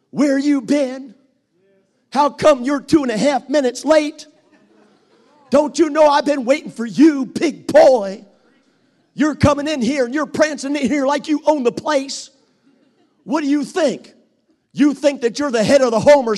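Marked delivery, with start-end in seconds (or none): 0.1-10.9 a loud, high, hammed-up nagging wife; mock her, whining at her husband big boy. Preacher acts out a nagging wife.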